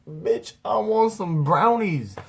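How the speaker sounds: high, loud and drawn-out demand; mock defiant yell. Stoned son demanding snacks from mom, mock yelling. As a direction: stretch it out as a hammed-up protest.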